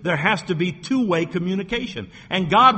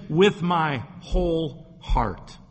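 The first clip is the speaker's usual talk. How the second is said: slow, weighty quoted emphasis; fervent quote; much slower than usual. Retold or imitated. Preacher quotes David's crying out to God, slow and fervent. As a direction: draw out each word of the quote. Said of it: "notice how fervent he was".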